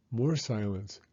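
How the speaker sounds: ordinary delivery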